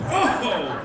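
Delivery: loud, high cry while falling; startled yell. Acted. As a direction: yelp as if slipping on ice. Pedestrian slipping on ice acted.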